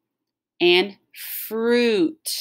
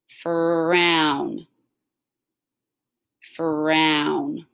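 "Frown" stretched out slowly; slow and smooth. Shown as a demonstration. Teacher stretches "Frown" for the class. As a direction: draw out "Frown" to hear the blend. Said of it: "say it slow and smooth".

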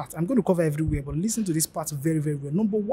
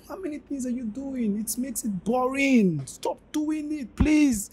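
exasperated; 7 semitones higher, slower than usual. Real, not acted. Exasperated by boring CV lines.

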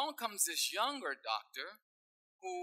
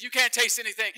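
loud, high disbelieving question; old doctor loudly feigns shock at the lost taste; mock astonishment; 6 semitones higher, 12 dB louder, faster than usual. Old doctor to patient, hammed-up loud astonishment.

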